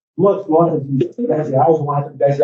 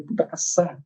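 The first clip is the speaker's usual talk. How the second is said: muttered thought; 10 dB quieter than usual. Retold or imitated. Father regretting his son's empty pockets, muttered thought. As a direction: let the curse slip out softly, as if only thought. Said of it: "falei um palavrãozinho no pensamento".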